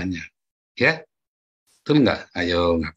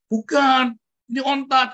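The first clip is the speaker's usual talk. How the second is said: scolding teacher; 14 semitones higher, 4 dB louder, slower than usual. Retold or imitated. Teacher corrects child, scolding.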